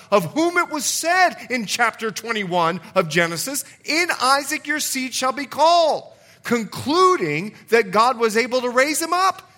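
preacher raising his voice to the church; preaching at full force. Acted. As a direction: build the fire of the sermon.

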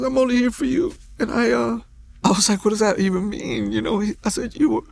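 real high, tearful voice breaking; choked up. Real, not acted. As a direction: fight back tears while telling it. Overcome with emotion during testimony.

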